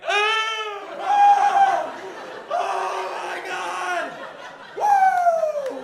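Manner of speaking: huge high gasping shouts, drawn out; gasping duck surfacing. Pretend. Duck surfacing after an hour, gasping and whooping. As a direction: burst up from underwater gulping air and whooping. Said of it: "come back up and be like".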